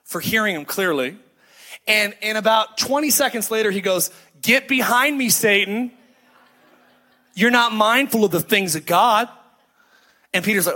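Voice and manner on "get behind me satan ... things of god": retold rebuke, firm and raised a little; stern rebuke. Jesus rebukes Peter, retold stern command. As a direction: rebuke someone with authority, as retold.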